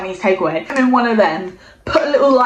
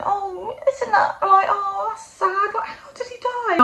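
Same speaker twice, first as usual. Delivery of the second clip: much higher, softer, slow pitying coo; sympathetic foster mum; 11 semitones higher, 3 dB quieter than usual. Retold or imitated. Foster mum pitying Kit over the dead hamster, high soft cooing. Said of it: "she's going".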